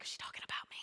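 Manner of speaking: mock whispered aside; whispered worry. Congregation member wondering about the sermon, whispered. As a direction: whisper it like a private question.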